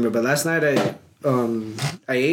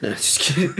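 ordinary talk, nothing marked

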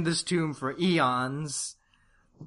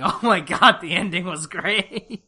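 laughing; 4 semitones higher, 6 dB louder than usual. Real, not acted. Laughing about the ending.

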